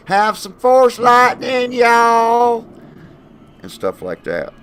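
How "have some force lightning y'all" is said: high, slow Southern drawl; exaggerated Southern belle accent. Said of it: "like, in her southern accent".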